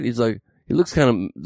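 ordinary talk

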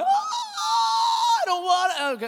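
high-pitched imitation of a wailing kid; hammed-up tearful protest; mock crying. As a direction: whine it like a little boy in tears. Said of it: "at least don't cry".

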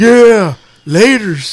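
put-on a light, loud Chicano accent on the line. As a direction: toss off a Chicano-accented goodbye. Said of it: "acting Mexican".

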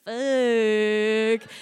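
one long drawn-out curse; stunned swearing. Retold or imitated. Woman reading the approval email, stunned swearing. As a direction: stretch it out in level disbelief.

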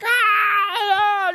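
high, loud mock crying wail; imitated toddler wail. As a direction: wail like a toddler fighting the toothbrush. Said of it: "just crying bloody murder".

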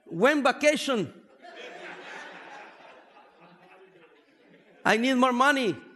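broken English. Retold or imitated. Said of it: "Lo primero que aprendí".

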